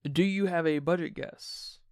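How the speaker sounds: ordinary delivery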